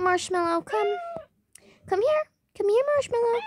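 sing-song call to the pet; calling Marshmallow the pet in a high coaxing voice. Real, not acted.

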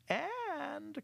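high drawn-out and; the cat holding the mice's attention; sly cat voice. Acted. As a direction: pause slyly before the idea. Clarence voiced.

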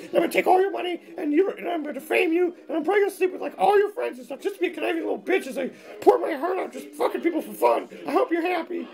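high falsetto threats; nagging ex-wife. Acted. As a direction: rant as a vindictive ex-wife in falsetto.

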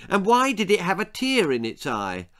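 put-on high-pitched boyish question; insistent boy Jack. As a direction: interrupt with another eager question.

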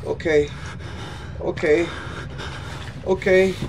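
usual speaking voice

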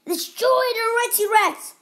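high shouted command; shouted order. A narrator's reading. Captain paddling to shore, shouting his order. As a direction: yell it back across the water. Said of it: "he cried as he paddled away".

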